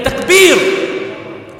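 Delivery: loud high shouted takbir; call of takbir. Real, not acted. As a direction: rouse the hall to answer. Calling crowd to cheer.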